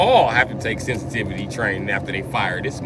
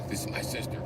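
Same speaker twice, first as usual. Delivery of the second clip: whispered aside; 13 dB quieter, faster than usual. Retold or imitated. Other voice on phone.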